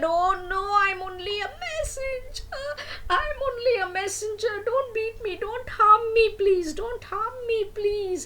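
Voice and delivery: high, wailing voice begging for mercy; hammed-up tearful begging, very high; crying plea. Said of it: "Sukha started crying".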